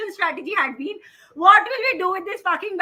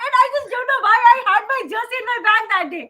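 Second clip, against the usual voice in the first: fast, laughing words, louder and higher; laughing while telling; 7 semitones higher, 4 dB louder, faster than usual. Real, not acted. Telling co-hosts about meeting the cricketer, laughing fast. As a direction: laugh your way through the line.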